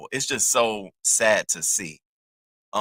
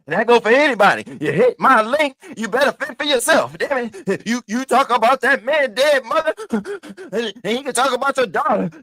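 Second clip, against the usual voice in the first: much higher and louder, worked up; heated high rant; 12 semitones higher, 7 dB louder than usual. Real, not acted. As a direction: lay into them from high up. Fired-up rant at viewers.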